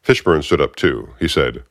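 ordinary delivery

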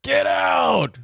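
high mock cry of get out; mock shout. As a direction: yell it like a mock banishment. Joint mock dismissal of has-beens.

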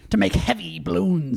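ordinary talk

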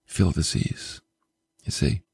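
low, soft storytelling aside; hushed matter-of-fact. Retold or imitated. Preacher telling how creation obeyed, low hushed statement. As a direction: drop low and quiet for the outcome. Narrated result after acted command.

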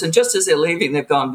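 ordinary talk

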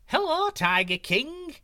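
very high, slow, loud monkey call; cheery monkey cry. Acted. Monkey calls down to the tiger, high and cheery. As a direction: sing out a greeting from the tree. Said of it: "cried a voice".

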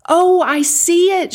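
high, loud cry of discovery; excited shout. A narrator's reading. Igor spots Captain Jackie's ship, shouting. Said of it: "shouted Igor".

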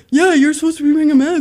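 a hint of whiny tattletale; mocking imitation. Mimicking the guy at the gym.

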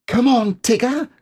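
very high piping call; excited cry. Acted. Little Roo eagerly calling to Tigger, high and excited. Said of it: "cried Roo".

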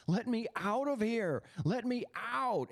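high, restrained repeated cry; imitated shouting. Man retelling a young woman's pleas, high mock shout. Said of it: "she just kept shouting over and over".

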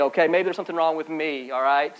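ordinary talk, nothing marked

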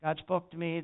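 ordinary delivery

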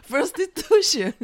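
slow, high mock foreign accent, laughing; Chester. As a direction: imitate an accent with a flourish through giggles. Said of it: "flare of an accent".

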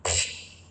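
an unvoiced puff of air, brushing it off; dismissive pfft. Dismissing her gut warning with a breathy pfft. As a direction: blow out a scornful little pfft. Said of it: "and I went".

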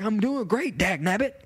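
perky mimicked greeting; coworker's catchphrase voice. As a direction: imitate a man with a folksy catchphrase. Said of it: "And that's how he talks".

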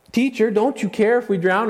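very high, loud distressed cry; frightened disciple demanding an answer from Jesus; desperate accusing plea. Retold or imitated. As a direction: hurl the question in panic. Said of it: "One saying".

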